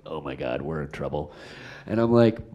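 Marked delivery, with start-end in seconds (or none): 0.0-1.3 very low, soft mock dread; dismayed aside. Director reacting to bad takes, hushed dismayed aside. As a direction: sink the voice, as if confiding.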